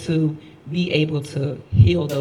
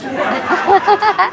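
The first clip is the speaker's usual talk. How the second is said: high, loud burst of laughter; 5 dB louder than usual. Real, not acted. Telling how she could get fired, high loud laughter. Joking about getting fired.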